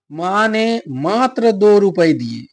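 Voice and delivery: slow and loud, मात्र drawn out with weight; stress on मात्र. Shown as a demonstration. Teacher showing how a particle adds force, मात्र stressed. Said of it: "देखिए बल डाल रहा है ना".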